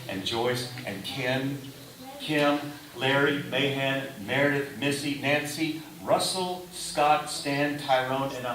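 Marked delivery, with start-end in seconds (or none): none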